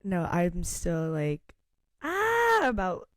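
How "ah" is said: high, loud "ah" of indecision; torn squeal. Acted. Torn fan still swooning about Charles, high squeal. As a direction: let out a high squeal mid-sentence.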